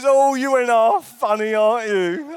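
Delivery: high, loud put-on old British lady voice. Shop cashier laughing at the joke, high loud old British lady voice. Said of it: "an old British thing".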